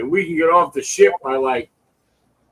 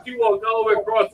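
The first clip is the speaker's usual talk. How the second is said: normal talking voice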